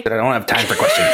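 high mock villain. Voicing Magneto brushing off.

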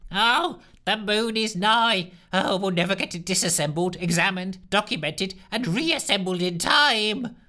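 a scientist wailing in despair; despondent wail. Acted. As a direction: wail as if all is lost. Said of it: "wailed Lushthorpe with utter despondency".

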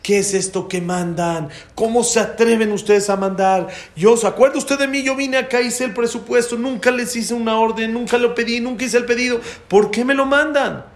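indignant shouting customer, high and loud; gritos furiosos imitados. Retold or imitated. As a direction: act out an outraged tirade, pitch climbing high. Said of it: "le empieza a gritar". (gritos furiosos de cliente indignado)

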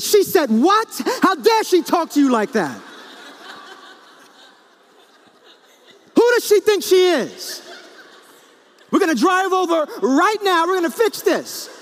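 loud, high mock-furious shouting; hammed-up outrage. Husband interrupting wife to share her anger, over-the-top outrage. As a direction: explode in disproportionate anger on her behalf. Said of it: "I gotta be angry with her".

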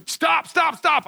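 high-pitched urgent retold plea; mock panic. Stuck kid telling backhoe driver to quit pulling, mock panic. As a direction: act out alarmed, rapid-fire begging. Begging rescuers to stop pulling.

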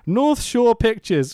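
slow, high, drawn-out announcement; mock title announcement. Podcaster reading the studio card again, mock announcement. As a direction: announce it grandly, stretching each word.

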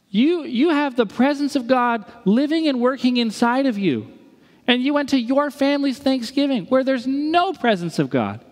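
high-pitched, insistent preaching; impassioned exhortation. Acted. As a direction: urge the sleeping giant to wake up. Exhorting the sleeping giant.

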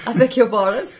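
high, loud laughing outrage; mock outrage. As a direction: gasp it out in shocked amusement. Mock outrage at robot joke.

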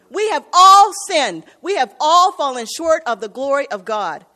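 rising to a loud preacher's cry; preaching at full force. Acted. Preacher insists to the congregation that all have sinned, loud. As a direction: hammer it home to the pews.

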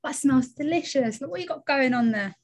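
high-pitched gushing over a neighbour's food; mock neighbourly admiration. Pretend visitor to the neighbour cooking, gushing admiration. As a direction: put on a high, delighted coo.

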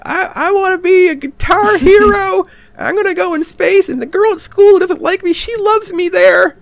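put-on very high, whiny little kid; sing-song like a little kid daydreaming; childish kid voice. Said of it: "It's almost like a kid wrote it".

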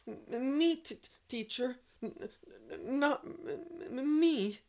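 high, loud, panicked stammering; wild stutter. A narrator's reading. Scared boy answering the angry teacher, wild stutter. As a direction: trip over every first sound in fright. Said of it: "stuttered out wildly".

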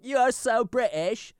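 high, slow mock British; British accent. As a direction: draw it out in a put-on British accent. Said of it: "I love that accent".

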